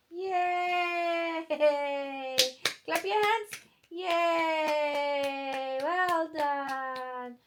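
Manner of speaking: high, drawn-out soft yay. Real, not acted. Mother praising baby for clapping, gentle high cheer.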